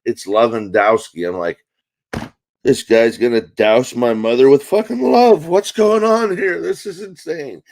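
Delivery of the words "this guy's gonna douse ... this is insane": loud outburst climbing high in disbelief; comic outrage. Acted. Son reacting to mom's boyfriend's surname, exaggerated outrage. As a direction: build into a hammed-up, high-pitched rant.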